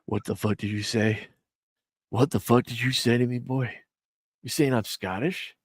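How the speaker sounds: low, menacing tough-guy voice, hammed up; mock offended Scotsman. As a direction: play a touchy Scot picking a fight, half joking. Mock offended Scot confronting him.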